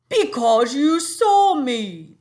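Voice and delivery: put-on a big friendly giant in a funny English accent, drawn out. Teacher voicing the giant for her class, funny accent. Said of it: "we just try to give him a funny accent too".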